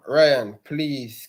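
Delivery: ordinary delivery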